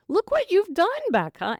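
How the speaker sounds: high, loud gushing praise; people praising. Retold or imitated. Friends praising her with a high, loud gushing voice. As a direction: gush like an admiring friend. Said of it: "people would say that to me".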